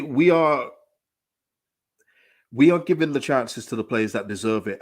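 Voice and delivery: ordinary talk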